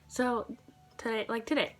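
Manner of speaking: ordinary talk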